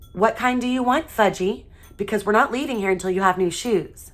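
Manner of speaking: mean every word, a parent done bargaining; firm mother. A narrator's reading. Mother to tantruming son, firm and determined. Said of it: "like she meant it".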